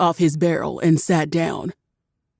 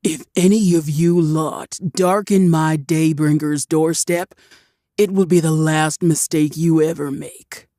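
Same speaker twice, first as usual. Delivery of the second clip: menacing threat. A narrator's reading. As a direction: lay down a cold threat. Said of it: "threat to the orc crew".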